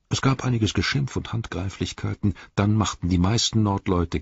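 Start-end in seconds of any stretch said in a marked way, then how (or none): none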